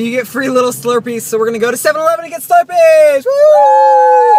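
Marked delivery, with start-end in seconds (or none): 1.2-4.4 fast, loud and high cheering; excited cheer. Real, not acted. Cheering about free Slurpees on 7-Eleven day. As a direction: whoop it out.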